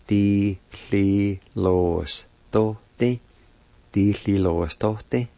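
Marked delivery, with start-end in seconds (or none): 0.1-3.2 slow teaching pronunciation, syllables set apart. Shown as a demonstration. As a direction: break the word into slow, separate syllables. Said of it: "is pronounced like this".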